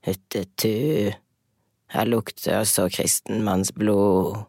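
troll voice. Acted. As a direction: drag it out like a sniffing troll. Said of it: "sa trollet".

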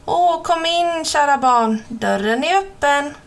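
the wolf straining to pass as grandma; wolf disguised as grandma lures the girl, fake granny voice; wolf faking grandma. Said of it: "Vad konstigt hon låter".

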